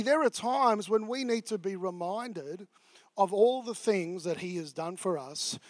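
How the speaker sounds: ordinary talk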